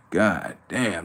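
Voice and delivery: ordinary talk, nothing marked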